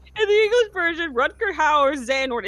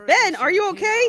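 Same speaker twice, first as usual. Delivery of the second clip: high, loud concerned ask; Aqua voice; 11 semitones higher, 5 dB louder than usual. Retold or imitated.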